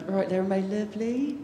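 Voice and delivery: light Bristol greeting, understated West Country lilt; a casual Bristol "All right there, my lovely" with a lilt on "lovely"; Bristol accent. Retold or imitated. Speaker shows how Bristol locals talk, in a light Bristol accent. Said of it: "everyone speaks very funny".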